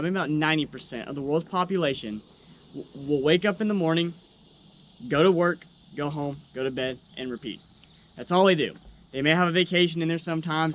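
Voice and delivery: usual speaking voice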